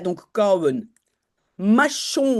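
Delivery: normal talking voice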